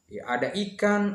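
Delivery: normal talking voice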